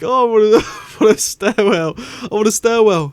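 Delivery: high, loud giggly mock Jackie; laughing through a mock Jackie line; imitating Jackie. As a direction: ham up her flustered answer. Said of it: "she's like".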